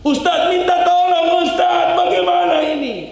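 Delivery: teriakan panik melengking tinggi; panik minta tolong. Retold or imitated. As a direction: berteriak panik meniru jamaah. (menirukan jamaah yang panik dengan suara tinggi keras)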